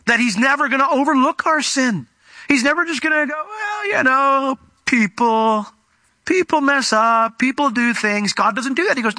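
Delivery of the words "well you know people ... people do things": high, slow, breezy brush-off; mock shrugging God. Mock God shrugging off people's sin, high and drawn out. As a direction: airily wave sin away as no big deal.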